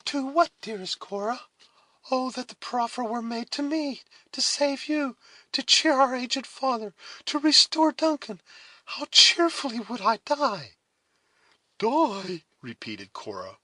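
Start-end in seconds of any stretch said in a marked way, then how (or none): 0.0-10.8 put-on high, fervent girl's voice, growing louder; impassioned younger sister. A narrator's reading. Alice offering herself to save family, impassioned. 11.8-12.4 high, firm single-word echo; calmer, firmer. A narrator's reading. Cora echoing her sister's word, firm and composed. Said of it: "with a calmer and firmer voice".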